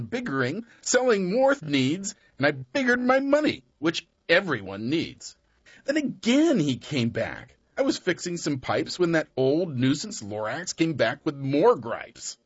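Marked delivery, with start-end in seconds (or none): none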